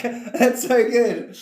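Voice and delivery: high, slow laughing squeal. Real, not acted.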